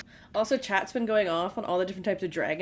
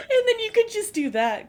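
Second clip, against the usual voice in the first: laughing, pitched up high; 14 semitones higher, 7 dB louder than usual. Real, not acted. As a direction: talk through a giggle. Laughing over dragon voice joke.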